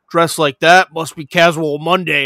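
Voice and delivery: a loud hammed-up sitcom quip; smug one-liner. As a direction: land the one-liner with swagger. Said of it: "He's like".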